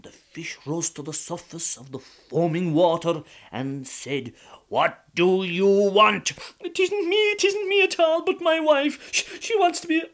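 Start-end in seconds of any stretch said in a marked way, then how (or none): 4.7-6.3 slow, louder otherworldly voice; the fish rising from the sea, slow and eerie; magic fish. Acted. 6.6-10.0 high, loud desperate protest; beg, blame the wife; pleading husband. Acted.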